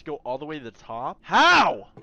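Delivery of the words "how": frustrated. Real, not acted. Frustrated yell.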